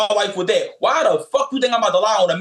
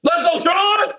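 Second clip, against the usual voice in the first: excited call; 12 semitones higher, much slower than usual. Real, not acted. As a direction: fire him up like a cheer. Calling out to host.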